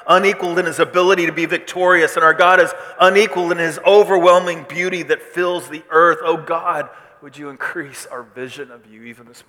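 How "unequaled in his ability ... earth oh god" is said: loud, high proclamation; crying out. Acted. Angels praising God over the throne, crying out loudly. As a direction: declare it at full force like worshipping angels. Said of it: "as they're crying this out".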